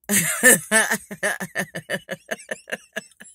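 long cackling laugh, trailing away; real laugh. Real, not acted. Storyteller cracking up at her deodorant comeback, long real laugh. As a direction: burst out cackling, then run out of breath.

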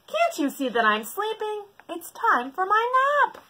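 high, loud cross complaint; cross bird. Acted. Sleepy bird scolds the animals, cross. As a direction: scold them.

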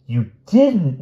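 high, loud dismayed wail. A narrator's reading. Francine wails at the druggist, horrified. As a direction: wail it in alarm. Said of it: "wailed Francine".

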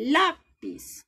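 Lápiz with LÁ high and loud, piz dropping; stress on LÁ. Shown as a demonstration. Teacher showing the accented syllable, Lápiz stressed on LÁ. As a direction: punch the first syllable LÁ of Lápiz. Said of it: "Entonces suena más fuerte".

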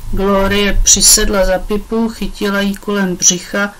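plain reading voice

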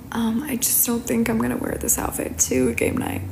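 hushed aside, barely voiced; whispering. Real, not acted. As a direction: drop to a whisper for this bit. Quiet aside while getting ready.

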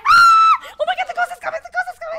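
high, loud panicked shrieking; real startled panic, very high and loud; panicked scream. Real, not acted. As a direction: scream in terror as it comes for you. Ghost coming at player in game.

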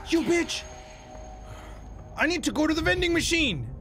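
high frustrated shout while fleeing; frustrated yelling. Real, not acted. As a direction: yell it out in exasperation.

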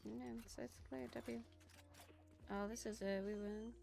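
usual speaking voice

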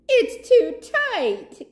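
a mock wheeze from inside the shell; wheezing. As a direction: squeeze the words out. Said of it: "he wheezed".

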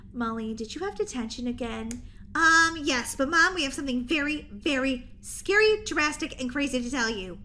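normal talking voice

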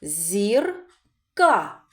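"Зірка" as ЗІР, pause, КА; syllable by syllable. Shown as a demonstration. Teacher counts syllables, "Зірка" said in two parts. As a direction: split "Зірка" into two separate beats. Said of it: "Скільки складів у цьому слові?".

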